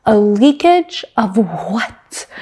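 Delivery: slow, drawn-out imitation, each syllable separate; enunciating every syllable. Engineer answering his driver on the radio, enunciating every syllable. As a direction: spell it out syllable by syllable, very slowly. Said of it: "Literally enunciating every syllable".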